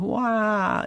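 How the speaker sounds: quick comic crying wail; Lucy Ricardo wail. Retold or imitated. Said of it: "Lucy Ricardo wailing".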